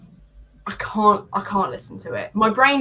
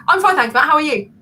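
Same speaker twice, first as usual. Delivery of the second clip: higher, louder, rushed answer; impatient quick reply; 6 semitones higher, 7 dB louder, faster than usual. Retold or imitated. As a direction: fire the reply back in one burst. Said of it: "I wanna talk like this".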